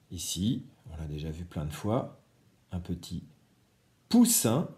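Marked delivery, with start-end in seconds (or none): 4.1-4.7 poussin lancé haut et fort; mot appuyé. Shown as a demonstration. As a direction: annonce poussin clairement à la classe. Mot-image dit fort à l'enfant. (la maîtresse appuie poussin haut et fort)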